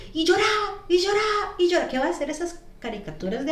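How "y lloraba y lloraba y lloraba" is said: rising, emphatic repeats; animated retelling. Real, not acted. Telling friends how she cried nonstop, excited. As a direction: build each one higher. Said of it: "retelling her uncontrolled crying".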